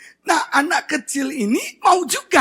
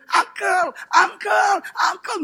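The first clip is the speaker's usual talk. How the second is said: put-on high little-boy call to a favourite uncle; sweet, high mimicked kid voice; affectionate child; 9 semitones higher, slower than usual. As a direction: imitate an excited nephew calling out. Imitates happy nephew greeting uncle.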